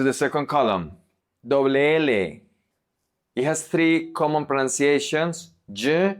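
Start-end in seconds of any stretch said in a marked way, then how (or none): 5.7-6.2 y said as a hard English j; hard j sound. Shown as a demonstration. Teacher listing the three pronunciations, hard j sound. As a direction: harden the y into a j sound. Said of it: "focus on the j sound".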